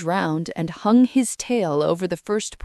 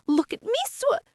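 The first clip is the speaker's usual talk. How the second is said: squeaky Roo. A narrator's reading. Roo cut off going under.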